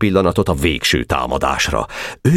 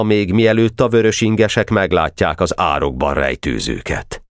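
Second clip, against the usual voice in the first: ordinary delivery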